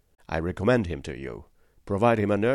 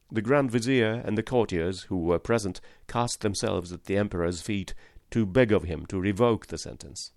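ordinary delivery

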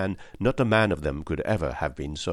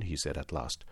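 usual speaking voice

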